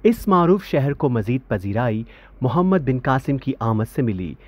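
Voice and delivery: normal talking voice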